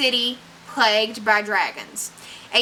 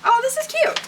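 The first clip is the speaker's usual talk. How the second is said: delighted. Real, not acted. Delighted by the cover art.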